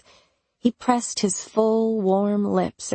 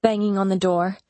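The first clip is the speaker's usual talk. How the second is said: plain reading voice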